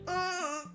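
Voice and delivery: high, soft imitated sob; mock sob. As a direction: sob briefly like the crying girl. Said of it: "she's, like, crying".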